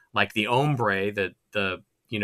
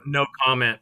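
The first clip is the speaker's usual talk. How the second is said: ordinary talk, nothing marked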